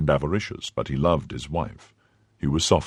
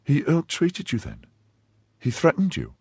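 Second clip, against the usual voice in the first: worried wife; 10 semitones higher than usual. Acted. Worried wife questions husband.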